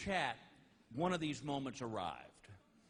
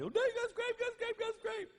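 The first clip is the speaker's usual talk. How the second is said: put-on much higher, whining little boy; hammed-up kid whine, pitched way up; whiny kid; 4 dB louder than usual. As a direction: whimper it like a hurt child. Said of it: "he was whining about it".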